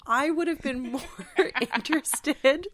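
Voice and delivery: words broken up by real giggles; laughing. Real, not acted. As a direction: laugh while you say it. Laughing through her answer.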